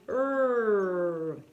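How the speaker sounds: "R" as a long curled "errr"; som do R inglês. Shown as a demonstration. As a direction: hold a retroflex R sound, not the letter name. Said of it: "Mas o som é esse". ("R" como "errr" retroflexo alongado)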